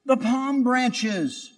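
high, slow, demanding shout. Acted. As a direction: cry out for the palms, indignant. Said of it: "he shouted".

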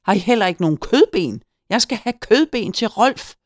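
loud, high indignant call; shouted demand. A narrator's reading. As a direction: holler it across the shop. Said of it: "hørte jeg Hyggen råbe igen".

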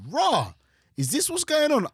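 high, loud stunned exclamation; shocked disbelief. Retold or imitated. Retelling his shock at the beach, high and loud surprise. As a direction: blurt it out in total disbelief. Shocked inner reaction retold.

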